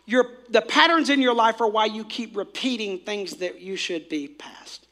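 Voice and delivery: usual speaking voice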